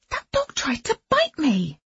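high, shocked outcry; startled. A narrator's reading. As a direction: cry out in alarm. Startled after dog's lunge.

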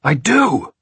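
high, loud protest; shouting. A narrator's reading. Locked-in man insisting he has resolve, shouting. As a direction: shout it back in desperation. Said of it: "Jack shouted".

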